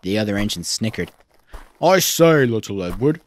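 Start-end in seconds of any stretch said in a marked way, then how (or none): 1.8-3.2 plummy, jovial put-on voice; Gordon. A narrator's reading. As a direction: hail a colleague teasingly. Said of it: "winked Gordon".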